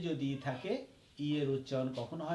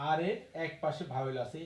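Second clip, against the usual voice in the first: ordinary talk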